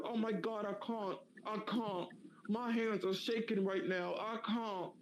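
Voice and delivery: high British gushing imitation; British streamer overwhelmed, hammed; mock British accent, high and breathless; mock British girl. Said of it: "She was British".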